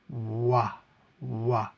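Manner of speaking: wah with lips pursed forward; wah, lips pushed out. Shown as a demonstration. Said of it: "make sure you purse your lips".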